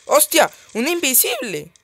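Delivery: high, loud burst of surprise; startled exclamation. Real, not acted. Player suddenly spotting an invisible zombie, loud startled cry. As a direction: blurt it out loudly, pitch jumping up.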